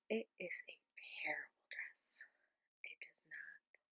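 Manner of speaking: unvoiced hushed whisper; library whisper. Real, not acted. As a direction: whisper it under your breath. Whispering late at night.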